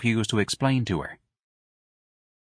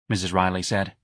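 usual speaking voice

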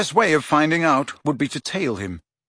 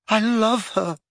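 higher, breathless and rapt; higher breathless swoon; 9 semitones higher, slower than usual. A narrator's reading. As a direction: gasp it out adoringly. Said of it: "repeated Ron breathlessly".

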